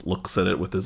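usual speaking voice

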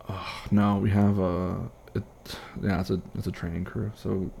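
normal talking voice

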